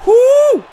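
high, loud whoo; startled whoop. Real, not acted. Gamer reacting to a close exchange, startled whoop. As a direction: let out a sharp high whoop.

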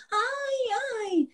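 high, soft, long-held "ow"; put-on high-pitched moan of pain; fake hurt wail.

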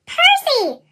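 high, loud call up to the ship; piercing high cry for a friend; urgent whistle call. A narrator's reading. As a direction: shout up, pleading. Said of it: "Thomas whistled".

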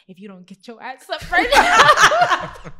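slow, loud line broken by laughter; laughing too hard to whisper the threat; retold whisper, laughing instead. Cousin's threat in a loud laughing voice. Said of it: "she whispered in my ear".

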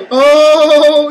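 loud, high startled "oh"; startled shout. Real, not acted. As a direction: jump and yell out loud. Sudden scare in game.

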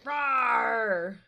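loud mock monster roar; drawn-out pretend growl; playful roar. Roars while kicking the gnome.

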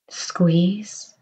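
a single whispered word; whispered cue to squeeze. Acted. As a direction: breathe it out, no voice.

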